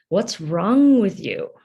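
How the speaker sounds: loud, drawn-out mock scolding; imitated angry parent. A father to his crying child, imitated scolding. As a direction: lean hard and slowly into the reproach.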